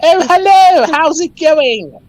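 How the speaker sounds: a wild-eyed cult leader preaching; crazy cultist voice. Pretend. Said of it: "my crazy cultist voice".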